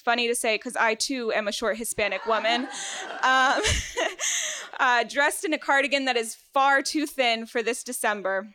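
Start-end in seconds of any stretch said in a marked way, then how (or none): 3.7-4.7 quick high giggle; high laugh. Real, not acted. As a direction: laugh at your own joke. Laughs at her own joke.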